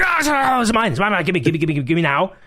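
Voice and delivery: high greedy cat voice, whining mine; possessive whining. Retold or imitated. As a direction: whine like a greedy cat grabbing treats. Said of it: "his greed sickens me".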